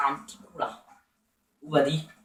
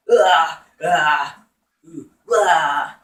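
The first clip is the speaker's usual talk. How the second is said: 1 pained yelps; 2 semitones lower, 9 dB louder, much slower than usual. Real, not acted. Getting hit in game.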